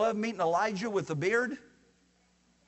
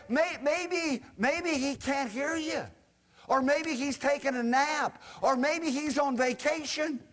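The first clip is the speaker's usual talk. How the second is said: taunting; 7 semitones higher, slower than usual. Acted. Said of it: "Elijah began to taunt them".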